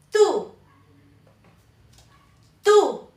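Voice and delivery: TÚ high, loud and falling; D between D and T. Shown as a demonstration. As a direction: punch out TÚ, T almost like D. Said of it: "la D se pronuncia entre D y T". (tú fuerte y agudo, entre D y T)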